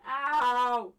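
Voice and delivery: loud, high pained yelps; sharp ouch. Real, not acted. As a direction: yelp in real pain from a cramp. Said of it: "Walking makes it worse".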